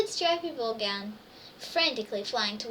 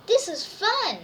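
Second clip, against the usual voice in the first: joyful; 7 semitones higher, 7 dB louder, slower than usual. A narrator's reading. Joyful line in story.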